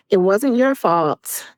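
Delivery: usual speaking voice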